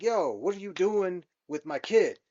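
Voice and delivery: half-acted outrage, a father demanding his kid; mad. Father in the game confronting kidnappers, mock angry shout. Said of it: "and I'm mad".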